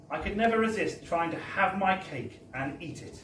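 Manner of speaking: ordinary delivery, nothing marked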